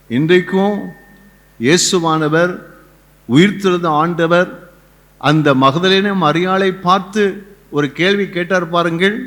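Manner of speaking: ordinary delivery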